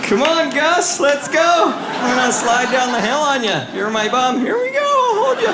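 high, hollering play-acted glee; mock excited sledder. Riding a homeless man down the hill, mock excited. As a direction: holler it like a hammed-up sled launch.

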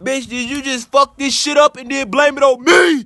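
ranting at the ex, shouting; angry shout. Acted. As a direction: shout the accusation at her. Yelling at ex in rant.

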